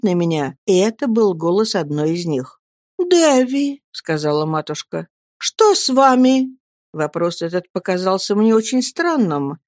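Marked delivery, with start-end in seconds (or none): 3.0-3.8 tender mother. A narrator's reading. Mother calls to crying boy. 5.4-6.6 worried mother. A narrator's reading.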